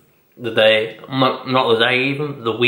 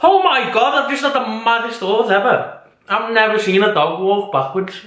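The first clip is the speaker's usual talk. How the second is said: sudden surprise; 8 semitones higher than usual. Real, not acted. Vlogger struck by a random thought, high surprised burst. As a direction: blurt out a sudden revelation. Sudden idea mid-vlog.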